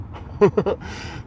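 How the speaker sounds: quick airless giggle; breathy laugh. Real, not acted. Laughs after a joke.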